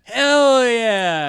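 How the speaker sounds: loud, high, drawn-out cheer; gang's cheer. Acted. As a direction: whoop it like an eager gang member. Gang cheers in the story.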